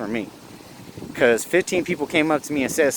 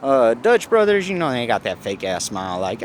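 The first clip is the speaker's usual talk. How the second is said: ordinary talk, nothing marked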